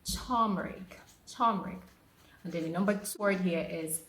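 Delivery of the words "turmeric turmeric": turmeric said "TAHM-ri-k"; careful model turmeric; correct turmeric. Shown as a demonstration. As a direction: teach turmeric clearly as TAHM-rik. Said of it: "The right pronunciation is turmeric".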